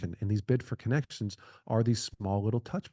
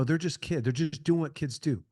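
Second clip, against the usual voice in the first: ordinary talk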